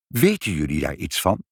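ordinary delivery

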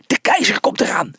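high, breathy announcement; excited, half-voiced cry; excited exclamation. A narrator's reading. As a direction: call out the news in breathless excitement. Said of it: "riep Felix opgewonden uit".

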